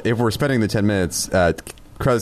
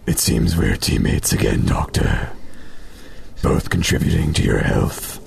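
low, slow alien voice; deep measured character voice; Kreska's voice; 9 semitones lower, slower than usual. Acted. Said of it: "Kreska says to Friss".

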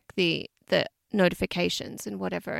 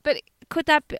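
usual speaking voice

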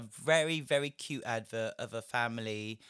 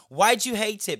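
angry demand; 8 semitones higher, 9 dB louder than usual. Real, not acted. Angry rant.

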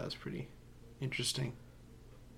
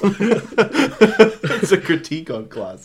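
high, loud and drawn out through laughter; laughing mock commentary; 8 semitones higher, 8 dB louder, much slower than usual. Real, not acted. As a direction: stretch it out, laughing. Laughing together, mock-grand correction.

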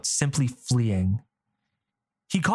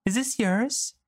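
put-on bright, higher girl asking; girl's voice; 9 semitones higher than usual.

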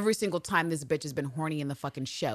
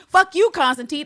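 louder, higher mock yell on the repeat; retold yell; 8 semitones higher, 8 dB louder than usual. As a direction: top the first one, shout it harder. Eve's retold rant at Villanelle.

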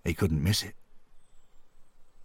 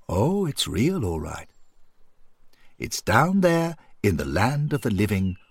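higher, swooping voice of an assuring friend; reassuring companion; 4 semitones higher than usual. A narrator's reading. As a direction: assure him in a lilting, raised voice. Voices Henry confirming the gravestone.

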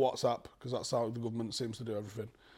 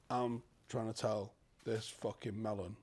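slow, lower and softer mock briefing; trying to stay straight-faced; 4 semitones lower, 6 dB quieter, slower than usual. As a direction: deliver it carefully, suppressing exasperation. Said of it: "trying to keep a straight face".

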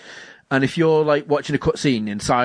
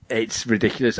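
ordinary talk

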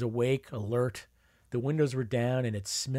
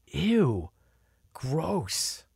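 put-on disgusted kids; 4 semitones higher than usual. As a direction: kid voice, grossed out. Kids spitting out boiled peanuts.

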